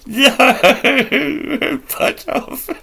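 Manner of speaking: real, high-pitched laughing fit through the words; helpless laughter. Real, not acted. Reader cracks up at a researcher's surname, helpless laughter. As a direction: squeal it out, cracking up.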